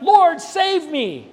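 high, loud, slow cry for help. A narrator's reading. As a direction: shout for rescue, drawn out. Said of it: "he cried out".

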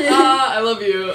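loud playful. Pretend. Loud sign-off to listeners.

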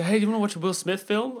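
high, loud mock quote; mocking imitation. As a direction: ham up a caller asking loudly. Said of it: "everyone goes when they say".

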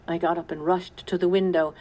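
ordinary delivery

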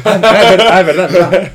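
high, loud laughing "ah, es verdad"; laughing realization. Real, not acted. Laughing as he realizes they've hit an hour ten. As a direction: laugh it off as it dawns on you.

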